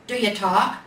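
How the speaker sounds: a gruff bear pushing for an answer. A narrator's reading. Big Bear presses the silent little bear, gruff. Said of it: "the big gruff voice asked".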